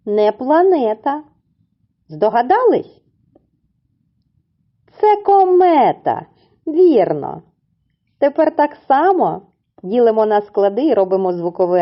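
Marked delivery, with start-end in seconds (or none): 5.0-6.3 Це комета much higher, louder and slowed; excited reveal. Acted. Teacher joyfully reveals the riddle answer to the little ones, excited. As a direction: sing out the answer like a big surprise.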